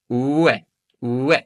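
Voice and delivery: ue, ue with oo sliding into eh, tightening each time; "ue, ue" as a shortening oo-eh glide; vowels meeting up. Shown as a demonstration. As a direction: say "ue" as oo-eh, drawing the two vowels together. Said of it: "listen for the sound when they meet up".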